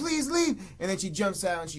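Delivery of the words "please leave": high-pitched pretend plea; mock pleading. Babysitter play-acts to scare the kid, mock pleading. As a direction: imitate her begging, voice pitched right up.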